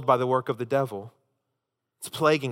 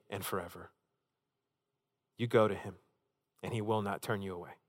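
softer, lower and unhurried to close; reassuring soft close; quiet closing invitation; 3 semitones lower, 7 dB quieter than usual. Acted. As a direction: invite them gently to go to him. Closing invitation over music.

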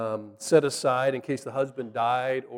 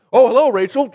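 loud, high show-off hello; show-off greeting; 7 semitones higher, 9 dB louder than usual. Retold or imitated. Jacob greeting Rachel while lifting the stone, showing off. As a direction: greet her loudly as if showing off your strength. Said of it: "Jacob in his feats of strength".

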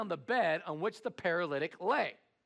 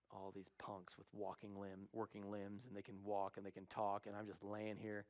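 bitter paralytic; 10 semitones lower, 14 dB quieter than usual. Retold or imitated. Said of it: "sitting there going".

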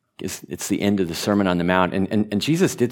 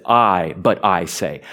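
slow, leaning on "I" in "I, but I say"; deliberate literal reading with "I" stressed; slower than usual. Shown as a demonstration. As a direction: read it word for word, weight on "I". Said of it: "literally, woodenly says".

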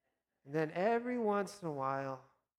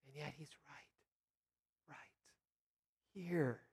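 hushed; 6 dB quieter than usual. Real, not acted. Intimate close of thought.

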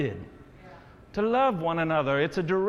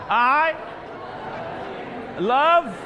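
rallying call-out; 6 semitones higher than usual. Shown as a demonstration. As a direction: call each word out to the room, leaving gaps. Urging congregation to tell somebody.

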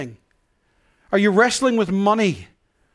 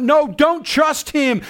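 raised high and slow, a half-acted yell; mock yell; 6 semitones higher, slower than usual. Preacher voices the world's warning as a slow raised yell. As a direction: call it out like a doubting crowd. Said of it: "a world which yelled out".

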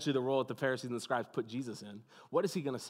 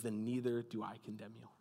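tender pardon; 6 semitones lower, 10 dB quieter than usual. A narrator's reading. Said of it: "looked on this broken woman".

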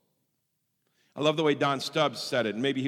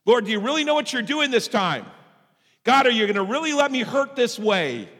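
loud, high plea; acted anguished questioning, raised voice; protesting to God; 7 semitones higher, 6 dB louder than usual. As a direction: cry out to heaven in complaint. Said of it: "when I go to God".

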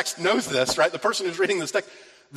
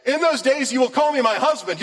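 raised, fervent voice of God; God's loving promise; 9 semitones higher, 4 dB louder than usual. Retold or imitated. God promising his people, raised and fervent. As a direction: proclaim it like a groom's vow. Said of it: "he's saying".

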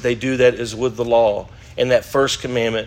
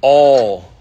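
All punched out much louder; stress on All; 7 dB louder than usual. Shown as a demonstration. Teacher showing a class the key word in scripture, stress on All. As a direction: hit All hard as one loud word. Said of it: "What's the emphasis on the word all".

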